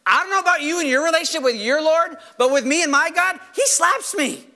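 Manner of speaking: playful retold defiance; defiant retort. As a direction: push back emphatically, rising on the last words. Retells his retort to critics.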